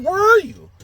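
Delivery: retold irate outburst; angry demand. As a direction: demand it in exasperated disbelief. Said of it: "Now I'm fucking getting angry".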